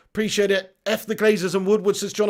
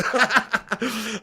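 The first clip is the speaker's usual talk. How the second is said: short giggling burst; real laugh; 5 semitones higher than usual. Real, not acted. Streamer gives up on doing the voice, laughing. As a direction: laugh as the impression falls apart.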